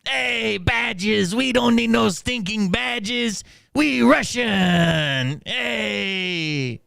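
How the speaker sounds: high-pitched mock Mexican bandit; hammed-up Mexican accent, last words stretched long; cringy Mexican accent. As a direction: play a cartoon Mexican tough. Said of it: "my Mexican impression probably sounds as cringy".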